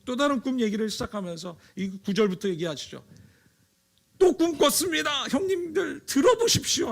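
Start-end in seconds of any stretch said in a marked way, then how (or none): 4.2-6.9 아주 높고 크게 들떠서 외치듯; 눈치 없이 신나서 형님들을 부르듯. Acted. Joseph boasting to brothers. (형님들에게 또 꿈을 자랑하는 요셉, 한껏 들뜬 높은 목소리)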